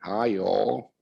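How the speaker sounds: a faint, half-hearted Southern American drawl on the greeting; Southern American accent. Retold or imitated. Imitating a Tennessee monk's greeting, faint Southern American accent. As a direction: barely try a Southern American accent, then give up. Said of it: "spoke with this wonderful Southern American accent".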